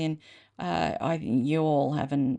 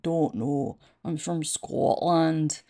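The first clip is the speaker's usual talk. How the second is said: slow, tentative Scottish accent; amateur go at a Scottish accent; attempted Scottish accent; 3 semitones lower, slower than usual. Shown as a demonstration. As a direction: try out a Scottish accent, drawing the words out. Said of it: "can do a Scottish accent".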